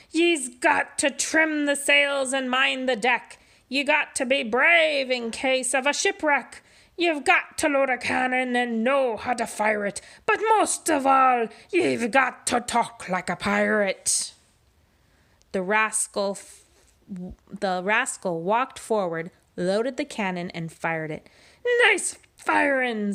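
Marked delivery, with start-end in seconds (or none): 0.1-14.3 gruff pirate captain speechifying, a bit higher. Acted. Pete's pirate voice. 21.8-23.1 slow, drawn-out pirate praise; pirate approval. Acted. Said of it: "said Pete".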